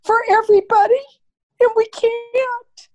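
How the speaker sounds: real tears pushing the words out; emotional. Real, not acted. Mother admitting weakness to her family, crying. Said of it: "I'm sorry if I'm emotional".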